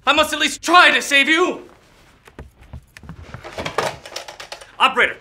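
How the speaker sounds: high, loud, urgent pleading; frantic urgency. Acted. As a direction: shout for help in a panic. Panicked call for an ambulance.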